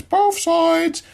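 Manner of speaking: "both sides" jumped way up in pitch; squeaky, insistent "both sides"; emphatic high repeat. Real, not acted. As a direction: hit it high to drive the point home.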